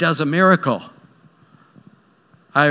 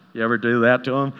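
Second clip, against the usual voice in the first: ordinary talk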